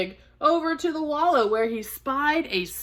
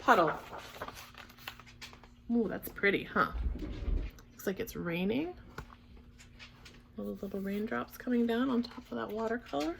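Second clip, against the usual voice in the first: soft chat over the pictures; quiet aside; 11 dB quieter than usual. Real, not acted. Aside about pictures to children.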